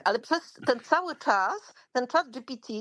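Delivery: ordinary talk, nothing marked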